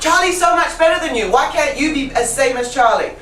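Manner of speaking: high, quick mock reproach; comparing teacher. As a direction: act out a teacher unfavourably comparing students. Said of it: "not competition".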